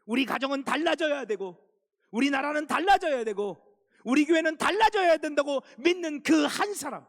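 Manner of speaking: rally the church at full force; rising pulpit cry that peaks on the last line; impassioned preaching shout. Real, not acted. Pastor's rising appeal to congregation.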